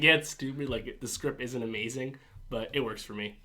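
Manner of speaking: normal talking voice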